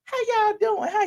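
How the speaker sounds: high excited squeal of hello; squealing greeting. Real, not acted. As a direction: greet them with a delighted squeal. Sing-song greeting to the chat.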